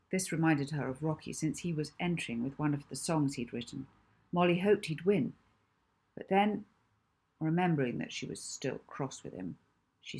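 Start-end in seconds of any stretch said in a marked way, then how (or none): none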